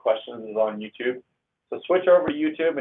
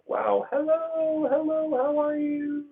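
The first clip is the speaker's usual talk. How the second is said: much higher, slow, sing-song greeting; squeaky excited hello; slower than usual. Acted. Streamer greeting a first-time live viewer in a high, drawn-out voice. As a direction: stretch each hello up high like greeting a friend.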